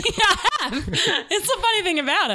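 high laughing amusement. Real, not acted. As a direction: laugh through the words. Laughs about being expert.

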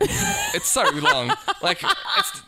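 high, slow, laughing. Real, not acted. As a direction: stretch it out laughing.

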